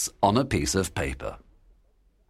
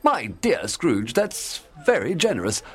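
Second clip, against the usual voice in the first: overwhelmed, higher-pitched thanks; grateful gentleman; 8 semitones higher than usual. A narrator's reading. Gentleman thanks Scrooge for generosity, overwhelmed.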